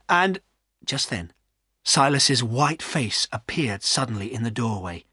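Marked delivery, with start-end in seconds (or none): none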